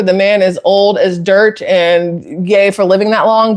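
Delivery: ordinary talk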